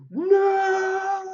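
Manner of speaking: high loud retold shout of no. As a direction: belt out her no in alarm. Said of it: "She said".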